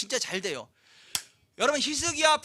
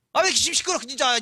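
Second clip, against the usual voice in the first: louder irritated neighbor's complaint; annoyed neighbor; 5 semitones higher, 5 dB louder than usual. Retold or imitated. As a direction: snap like a fed-up neighbor.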